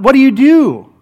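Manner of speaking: normal talking voice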